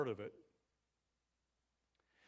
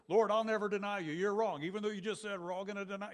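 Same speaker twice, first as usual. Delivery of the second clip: loud, higher, cocky protest; boastful Peter; 6 semitones higher, 6 dB louder than usual. Retold or imitated. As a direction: brag like a big-mouthed disciple. Preacher voices Peter boasting to Jesus.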